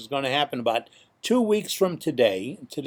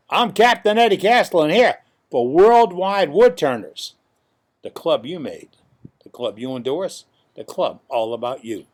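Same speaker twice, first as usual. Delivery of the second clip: announcer sign-off; 6 dB louder than usual. Acted. Signs off like a radio ad.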